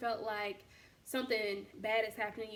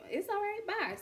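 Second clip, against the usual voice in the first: breezy goodbye; 10 semitones higher than usual. Retold or imitated.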